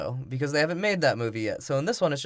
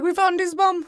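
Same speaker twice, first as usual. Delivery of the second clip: put-on high-pitched, louder caricature of Middle Eastern accent; hammed-up Middle Eastern voice, pitched way up; mock Middle Eastern accent; 5 dB louder than usual. Man handed a bomb, mock Middle Eastern accent. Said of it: "like, Middle Eastern gave him a bomb".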